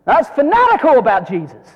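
loud, high burst on fanatical; emphatic fervor. Real, not acted. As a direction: proclaim it with full fire. Preacher's emphatic declaration.

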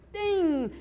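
high, loud mimicked ding of snuff hitting the spittoon; imitated spit ding. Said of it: "and she'd go".

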